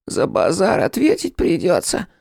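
high rodent thug voice; thin squeaky voice. A narrator's reading. Second rat backing up his mate, thin squeaky threat. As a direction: squeak a threat, high but short of the tiniest pitch. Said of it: "Голосом пониже, чем у первого, но всё равно тонким, пропищал".

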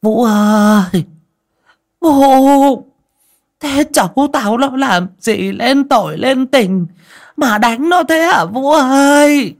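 high, loud, stretched-out wail of protest; old woman hollering. A narrator's reading. Grandmother confronts daughter-in-law over beaten grandchild, hollering. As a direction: holler out in outrage, dragging the calls. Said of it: "mà bà gào lên".

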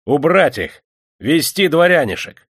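barked command. A narrator's reading. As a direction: order your men about, loud and high. Атаман отдаёт приказ казакам. (громкий приказ атамана)

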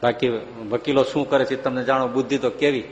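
usual speaking voice

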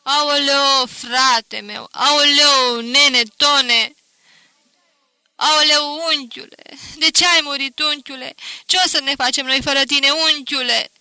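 high, loud keening over the dead; loud high mourning cry for the dead uncle; wailing lament. A narrator's reading. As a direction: wail it out like village mourners. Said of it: "se puseră pe plâns și pe jelit".

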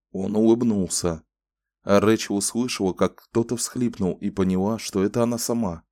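plain reading voice

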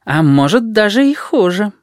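musing character voice, lifting high at the end; thoughtful. A narrator's reading. Jackal recalls his Homer, thoughtful and high. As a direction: add it as a pondered afterthought, pitch rising. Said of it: "добавил он задумчиво".

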